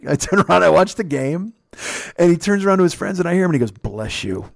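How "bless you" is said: low, soft mimicking mutter; mock muttering. Old man mutters to his friends, mocking the blessing, muttering. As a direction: imitate him grumbling it under the breath. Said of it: "like, muttering".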